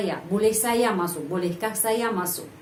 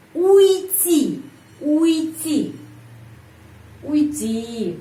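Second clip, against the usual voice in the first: "уйти" drawn out, stressed on the last syllable; word stress demo; 5 semitones higher, 4 dB louder, much slower than usual. Shown as a demonstration. Teacher shows where stress falls, emphatic "уйти". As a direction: model "уйти" slowly, leaning on its ending. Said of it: "penekanan di sini".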